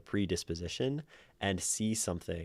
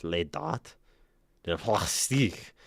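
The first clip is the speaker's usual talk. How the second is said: put-on French accent; 3 dB louder, slower than usual. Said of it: "do shitty French accent".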